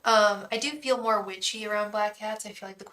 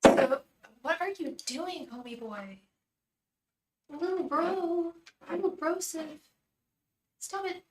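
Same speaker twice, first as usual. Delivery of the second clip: higher-pitched playful cat talk; pet talk; 7 semitones higher than usual. Real, not acted. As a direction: coo at the cat in a raised voice.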